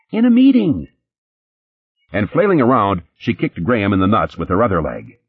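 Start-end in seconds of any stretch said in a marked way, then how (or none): 0.1-0.9 very high, louder squeal. Acted. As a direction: squeal it hysterically. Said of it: "the girl squealed".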